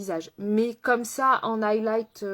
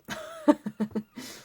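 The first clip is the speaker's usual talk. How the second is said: high burst of laughter. Real, not acted. Host laughing at her own forgetfulness, high laugh.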